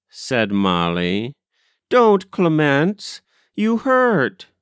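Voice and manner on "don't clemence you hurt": put-on high, slow child's complaint; pained protest. A narrator's reading. Molly shaken by Clemence, pained protest. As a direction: protest high-pitched, drawing out the plea.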